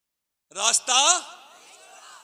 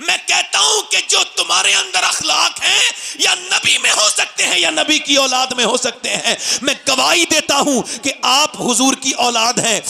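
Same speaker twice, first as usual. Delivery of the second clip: impassioned preaching; 10 semitones higher than usual. Acted. Preacher rouses the gathering about Bilal and Abu Jahl, shouting. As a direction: drive it home to the crowd at full force. Preacher builds to full force.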